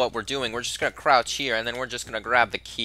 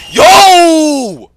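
startled yell; 18 dB louder than usual. Real, not acted.

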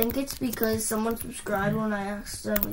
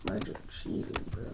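whispered; 2 semitones lower, 10 dB quieter, much faster than usual. Real, not acted. Whispered accusation.

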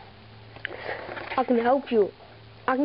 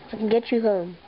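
normal talking voice

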